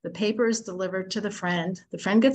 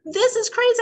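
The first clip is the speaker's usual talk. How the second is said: high, loud burst of disbelief; outraged exclamation; 13 semitones higher, 5 dB louder than usual. Retold or imitated. Man fuming at the neighbor who reads his paper, high and loud. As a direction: throw your hands up at the nerve of it.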